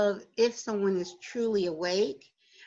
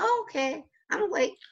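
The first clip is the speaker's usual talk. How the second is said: high, breezy mock realization; mock naive realization; 8 semitones higher than usual. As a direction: act smugly pleased to be awake. Mocking naive awakening claim.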